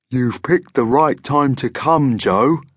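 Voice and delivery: slow, friendly monster voice; Fuzby. A narrator's reading. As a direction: welcome the boy as a genial monster boss. Monster welcomes Joe inside.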